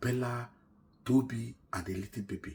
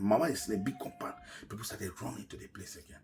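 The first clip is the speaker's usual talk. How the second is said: ordinary talk, nothing marked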